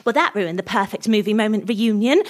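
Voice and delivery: ordinary delivery, nothing marked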